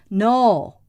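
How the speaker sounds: emphatic Irish "no"; Irish accent. Shown as a demonstration. Showing the word an Irish character says a lot, Irish accent. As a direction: say "no" with an Irish vowel, punched. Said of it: "I can do an Irish accent".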